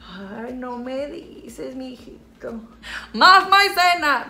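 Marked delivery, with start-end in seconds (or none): 3.1-4.3 slow, loud and higher cry of more cornstarch; exclaim. Real, not acted. Applying makeup, demanding more cornstarch: loud slow cry. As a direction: call it out with gusto.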